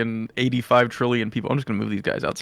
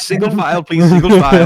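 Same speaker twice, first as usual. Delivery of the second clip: stern usher voice; 7 semitones higher, 9 dB louder than usual. Acted. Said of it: "Moro goes".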